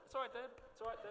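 high, urgent soothing; calming dad down. Retold or imitated. Son grabbing dad's legs at the game, high urgent reassurance. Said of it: "I was like".

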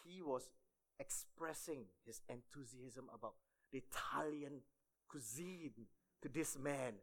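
ordinary delivery

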